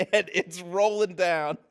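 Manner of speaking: ordinary delivery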